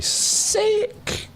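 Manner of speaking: very high, squeaky delighted "Sick!"; excited squeal. Real, not acted. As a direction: squeal it like a thrilled fan. Excited reaction to Lego set.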